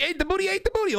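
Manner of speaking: ate the booty squeaked up high; teasing squeal. Real, not acted. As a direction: jump way up in pitch to tease. Teasing pitch jump.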